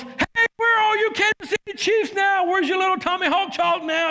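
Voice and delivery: high mocking taunt at rival fans; taunting yell. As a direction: jeer at the other side. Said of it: "[name] yells".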